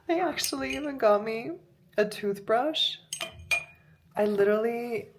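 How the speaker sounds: excited amazement. Real, not acted. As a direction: gush over a surprise gift. Amazed by gifts.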